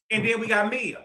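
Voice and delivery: ordinary talk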